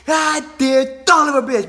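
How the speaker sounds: high, loud imitation of cursing; hammed-up outburst of shock; shocked dad. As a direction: mimic dad's stunned swearing. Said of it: "he's all shocked".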